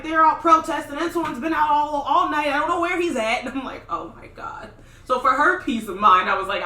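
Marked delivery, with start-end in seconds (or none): 0.0-3.4 high, loud panicked mother; frantic mom. Retold or imitated. Retelling her mother's frantic phone call, high and loud. 3.9-4.7 whispered oh my god; breathless. Retold or imitated. Hearing her brothers are missing, a whispered gasp. Reacting to mom's news.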